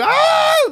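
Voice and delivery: very high, loud imitated shriek; mock scream. Hikers seeing guns pointed at them, loud mock scream.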